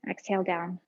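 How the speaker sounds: ordinary talk, nothing marked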